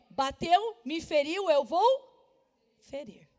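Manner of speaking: building to a loud, indignant cry; rising anger, hit each word harder; angry outburst. Acted. Acting out the urge to retaliate.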